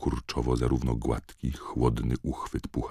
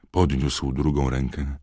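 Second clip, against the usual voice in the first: ordinary delivery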